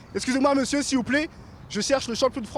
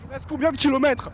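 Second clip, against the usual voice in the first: repeated louder so he hears; 4 semitones higher than usual. Real, not acted. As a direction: say it again, louder and clearer.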